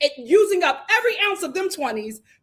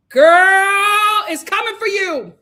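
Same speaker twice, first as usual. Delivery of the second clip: normal talking voice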